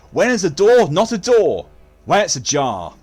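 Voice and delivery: loud, high punchline delivery building to a groan-worthy payoff; hammed-up dad joke. Streamer hamming up a dad joke for chat, loud and high.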